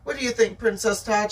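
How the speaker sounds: usual speaking voice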